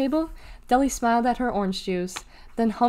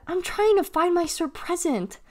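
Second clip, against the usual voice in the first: high, exasperated protest; exasperated holler; 9 semitones higher than usual. A narrator's reading. Deli explains to R B, exasperated and high. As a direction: protest to a tagalong, pitched well up. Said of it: "she hollered".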